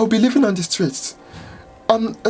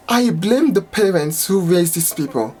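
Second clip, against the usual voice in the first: ordinary delivery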